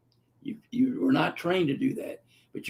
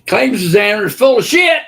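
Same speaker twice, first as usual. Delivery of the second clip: indignant shout; 6 semitones higher, 11 dB louder than usual. Real, not acted. Indignant outburst at examiner.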